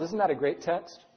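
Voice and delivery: ordinary talk, nothing marked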